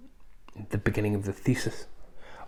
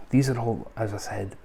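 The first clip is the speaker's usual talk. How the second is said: ordinary talk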